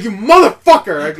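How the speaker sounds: loud, drawn-out high curse; pissed-off outburst. Retold or imitated. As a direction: curse in re-enacted outrage. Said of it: "I was so pissed".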